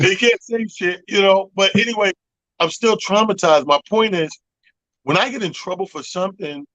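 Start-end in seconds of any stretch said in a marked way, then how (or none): none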